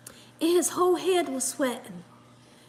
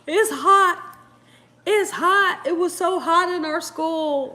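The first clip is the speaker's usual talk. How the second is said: imitating Dakota; 5 semitones higher than usual. Mimicking Dakota's heat complaint, mock whine. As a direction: play the overheated kid whining. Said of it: "Dakota's like".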